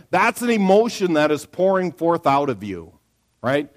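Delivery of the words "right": normal talking voice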